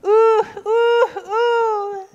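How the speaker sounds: very high, loud hooting screech; repeated high screeched oohs. A narrator's reading. Lion joins the fake tantrum, screeching. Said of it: "screeched Lion".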